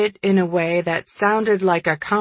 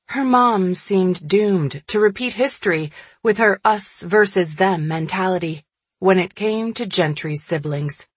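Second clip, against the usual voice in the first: ordinary delivery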